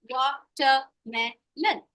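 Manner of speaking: watermelon broken slowly into syllables; slow syllabified. Shown as a demonstration. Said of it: "When I say watermelon".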